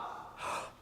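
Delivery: sharp audible gasp for air; demo breath intake. Shown as a demonstration. Conductor demonstrating the breath before the downbeat, a sharp gasp. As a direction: show the band the big breath. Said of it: "You take the breath with them".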